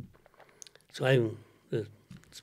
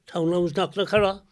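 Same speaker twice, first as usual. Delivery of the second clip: put-on a light Cork accent carried on; 5 semitones higher, 4 dB louder, faster than usual. [name] checks the host is following, still in a Cork accent. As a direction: keep the Cork lilt going as you ask. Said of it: "That's a great accent".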